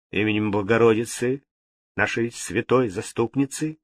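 ordinary delivery, nothing marked

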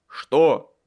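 loud, abrupt call back; shouted question. A narrator's reading. Friend shouts back from afar. (громкий вопрос издалека)

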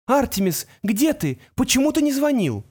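high worried mother's voice; rising alarm, a mother calling out; anxious mother. A narrator's reading.

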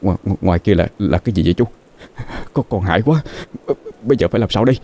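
scared stammer, as if out of air; frightened, broken-up plea; gasping for breath. A narrator's reading. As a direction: catch on the words in breathless panic. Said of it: "anh nói như người bị đứt hơi".

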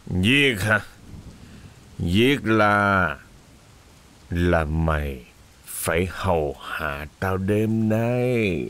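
low, slow leering threat; menacing drunk master. A narrator's reading. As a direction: savor each word as a threat.

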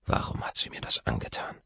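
unvoiced whisper of inner anger; whispered thought. Man thinking about his ex, whispered. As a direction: whisper it to yourself, bitter.

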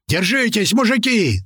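high, drawn warning shout. A narrator's reading. Driver warning the men before impact, shouting. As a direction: cry out a warning, pitch climbing high. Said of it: "закричал Рыжий".

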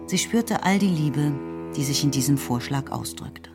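ordinary talk

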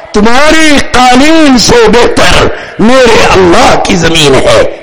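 slow, drawn-out acted proclamation; bold declaration. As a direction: stretch each phrase as a bold retort. Acts out companion's defiant declaration. (ٹھہر ٹھہر کر جرات مندانہ اعلان)

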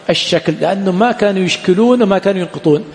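ordinary talk, nothing marked

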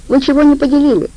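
guard asks what they fought over; surprised guard. Acted. Guard demands of the scratched girls, high and puzzled.

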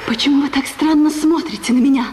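ordinary talk, nothing marked

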